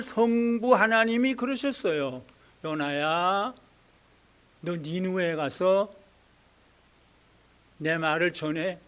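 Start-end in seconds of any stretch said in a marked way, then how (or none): 2.6-8.8 quiet God voice. Acted. God commanding Jonah.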